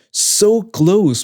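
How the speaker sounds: "so close" stretched out, pitched high; drawn out, condescending. Shown as a demonstration. Husband quotes wife's pet phrase, drawn out. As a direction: draw out "so close" mockingly. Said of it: "Really drawing out the".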